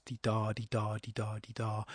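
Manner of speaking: "dee da" with DA stressed each time; iambic beat. Shown as a demonstration. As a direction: regular weak-strong chant on dee DA. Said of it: "an unstressed syllable by a stressed syllable".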